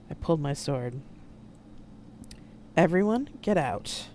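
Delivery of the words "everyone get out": ordinary delivery, nothing marked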